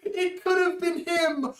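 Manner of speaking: high, pained cries of frustration; anguished high yelling. Real, not acted. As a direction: wail at the screen like a fan. Missed chance by his player.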